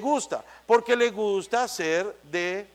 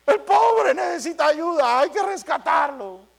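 indignant compassionate outcry; 9 semitones higher, 6 dB louder than usual. Retold or imitated. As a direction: cry out to rescue the poor sinner. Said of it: "el que tiene el don de misericordia le dice".

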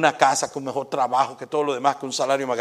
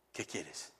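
soft, lower, hushed voice from a dream; 10 semitones lower, 20 dB quieter than usual. Retold or imitated. Mysterious voice in a dream asking the shopkeeper, hushed. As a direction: speak low like a voice in a sleeper's dream. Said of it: "que le dijo".

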